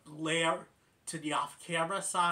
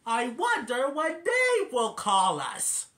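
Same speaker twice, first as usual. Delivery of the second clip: louder, higher and slow; loud Alvin; 8 semitones higher, 8 dB louder, slower than usual. Retold or imitated. Alvin loudly hinting for the phone call, higher voice. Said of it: "Alvin loudly goes".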